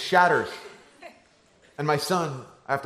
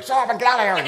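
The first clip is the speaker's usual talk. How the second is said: higher, laughing mock-snappy big brother; annoyed kid subtext; 8 semitones higher, 4 dB louder, faster than usual. Big brother telling siblings to leave, mock irritated. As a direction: play the real irritated meaning. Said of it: "I'm translating the subtext".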